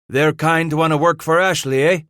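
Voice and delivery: a touch of a Spanish-tinged growl at the free trappers. Acted. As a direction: growl it out in scorn. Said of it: "Vasquez growled".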